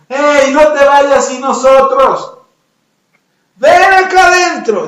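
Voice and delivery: high, loud, drawn-out holler; shouting after someone. Acted. Frogs left inside call the escapee back, shouting. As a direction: yell for them to come back. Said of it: "les gritaron las de adentro".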